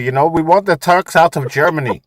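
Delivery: lightly put-on German accent, imitating a far-right guy; mock German accent. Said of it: "he used to say".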